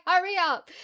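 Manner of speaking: high, loud hurry up; shouting mum along; urgent shout. Retold or imitated. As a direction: push her on, loudly. Rushing mum to the gate.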